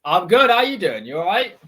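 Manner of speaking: loud, high, cheery greeting in a broad Wiltshire accent. Real, not acted. Son greets his dad on the show in a broad Wiltshire accent. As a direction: lean into the Wiltshire accent as you say hello. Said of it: "you sound more and more Wiltshire every time".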